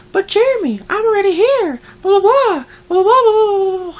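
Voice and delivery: high whiny mock voice with a laugh; hammed-up high impression of her; mocking coworker. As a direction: squeal it like a mocked coworker. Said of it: "then she's like".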